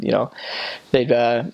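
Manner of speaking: ordinary talk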